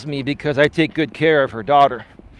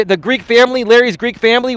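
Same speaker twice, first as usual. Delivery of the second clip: ordinary talk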